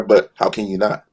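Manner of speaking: ordinary delivery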